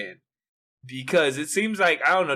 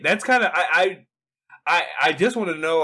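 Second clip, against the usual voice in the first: ordinary delivery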